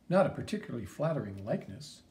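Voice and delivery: ordinary talk, nothing marked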